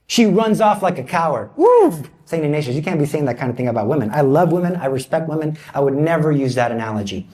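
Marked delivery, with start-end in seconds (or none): 1.6-1.9 a high whoop of delight; excited whoop. Real, not acted. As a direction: cheer it like a fan. Cheering the saint's line.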